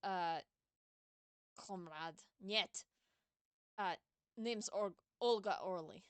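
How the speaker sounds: put-on Russian accent for a game character. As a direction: play her with a Russian accent. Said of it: "I was doing a Russian accent for her".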